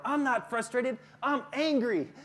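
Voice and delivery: mock-angry parent; angry retort. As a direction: play an irritated parent snapping back. Acts out parent correcting the teacher.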